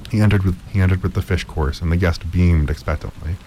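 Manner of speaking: plain reading voice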